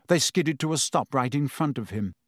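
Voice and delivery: ordinary delivery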